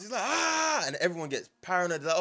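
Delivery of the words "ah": high, drawn-out mock yell "aaah"; retold shout. Retelling Zoro shouting out of his sleep, mock shout. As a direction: imitate a startled shout without full volume. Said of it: "he just shouts".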